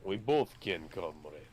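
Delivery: put-on jokey Russian accent, trailing off low on comrade; light Russian accent, for laughs; mock Russian accent. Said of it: "better Russian accent".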